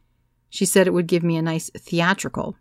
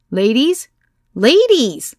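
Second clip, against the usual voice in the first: higher and louder, rising then falling call; 7 semitones higher, 7 dB louder, much slower than usual. A narrator's reading. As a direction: call the room to order. Calling out to noisy room.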